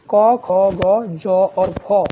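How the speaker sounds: क ख ग ज फ spelled out slowly, dotted foreign sounds; nukta sounds demo. Shown as a demonstration. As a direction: drag out each dotted letter. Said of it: "नुक्ता वाले शब्द है".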